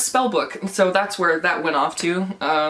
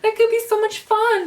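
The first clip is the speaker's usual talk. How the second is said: hammed-up giddy internet fan; mock excited fans; 3 dB louder than usual. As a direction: parody a naive fan gushing over a sequel. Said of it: "I know people on the internet say".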